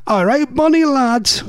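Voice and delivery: high, badly faked Geordie greeting; attempted Geordie accent. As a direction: have a hammed-up go at Geordie. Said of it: "I can't do accents".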